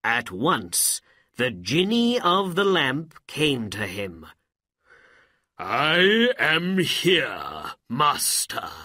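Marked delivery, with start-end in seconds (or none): 5.6-8.7 loud, very slow, grand genie voice; summoned genie. A narrator's reading. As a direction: announce yourself like a genie, drawn out. Jinni appears from the lamp.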